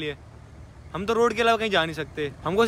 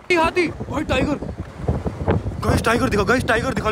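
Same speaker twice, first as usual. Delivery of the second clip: excited; 5 semitones higher than usual. Real, not acted. Spots elephant and tiger.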